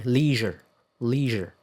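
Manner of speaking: leisure with a long "ee", as "LEE-zher"; American pronunciation. Shown as a demonstration. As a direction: stretch the first vowel of leisure to "ee".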